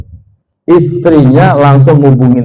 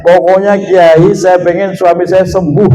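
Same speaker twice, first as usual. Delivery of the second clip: higher, insistent mock demand; lightly voiced demanding wife, pitched up; imitated confronting wife; 4 semitones higher than usual. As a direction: act out a wife pressing the kiai. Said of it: "Langsung yang dilabrak saya".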